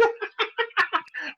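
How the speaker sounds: put-on very high childlike giggle. Dad imitating his toddler's giggle after the prank, high giggle.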